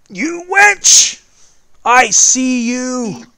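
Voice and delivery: high, loud accusing yell; furious shout. Acted. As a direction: yell it across the crowd. GM voices angry pursuer.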